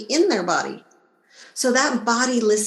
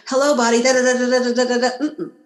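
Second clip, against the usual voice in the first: louder rattling babble that never pauses; nonstop chatter; 2 semitones higher, 4 dB louder, much faster than usual. Shown as a demonstration. Talking over her own body in loud nonstop babble. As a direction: talk at it without a breath. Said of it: "That's the trick".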